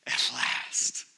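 breathed out in a hushed whisper; awed whisper. Acted. Adam on first seeing the woman, whispered relief. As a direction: whisper it as if the long wait is over.

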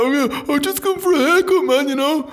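high, loud flustered over-friendly answer; nervous eager reply. Retold or imitated. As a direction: babble it out, trying too hard to fit in. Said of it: "I'm like".

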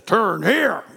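barked high military commands; loud mock army commander; General Patton voice. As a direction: bark orders like a drill general. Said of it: "he sounds like, uh, General Patton".

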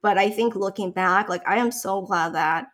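ordinary talk

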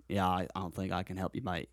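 put-on low, soft, casual Australian accent; Australian local. Australian man apologizing to a lost visitor, soft Australian accent. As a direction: turn them down like a lightly voiced Aussie. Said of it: "a guy in the lobby in Australia".

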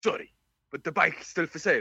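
reply as the seller in a put-on South Wales accent; [name], South Walian accent. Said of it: "do the South Walian accent".

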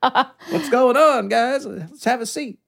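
high, drawn-out, laughing mock greeting; playful intruder greeting. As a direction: burst in with a high, breezy hello, half laughing. Acting out barging in unannounced.

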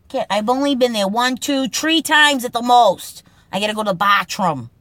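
put-on a Boston guy rushing off to the bathroom, in a Boston accent. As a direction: rattle it off like a Boston local. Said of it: "Boston Speak segment, Kid lines".

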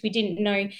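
ordinary talk, nothing marked

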